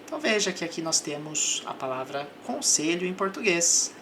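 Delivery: ordinary talk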